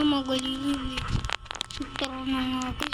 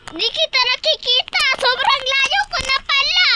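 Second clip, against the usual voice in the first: excited shout; 7 semitones higher, 4 dB louder than usual. Real, not acted. Excited loud realization.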